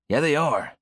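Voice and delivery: arguing. Acted. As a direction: argue it, in a mood. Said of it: "I argue".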